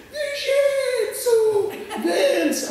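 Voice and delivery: high, loud, drawn-out call across the plane; grandma shouting his name from the back. Retold or imitated. Grandma spotting grandson, calling out loud.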